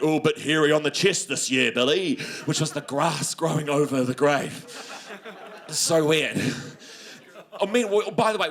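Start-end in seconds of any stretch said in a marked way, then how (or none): 0.0-2.2 dad's dark joke. Retold or imitated. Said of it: "dark joke where he'd be like".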